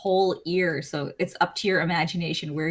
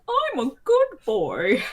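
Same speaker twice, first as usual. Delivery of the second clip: higher, slow mock-innocent whine; whiny good boy; 6 semitones higher than usual. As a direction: insist you're good like a kid. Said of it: "He's like".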